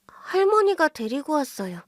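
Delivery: put-on small child. Child answers the husband.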